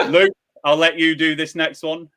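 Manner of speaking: ordinary talk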